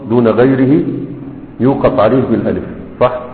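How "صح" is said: normal talking voice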